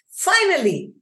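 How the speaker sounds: high, loud cry of relief; exclaimed. Retold or imitated. The first man meeting the woman, exclaiming high and loud. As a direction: burst out with it, overjoyed. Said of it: "the man exclaimed".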